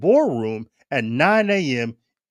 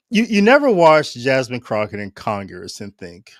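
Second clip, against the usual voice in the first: usual speaking voice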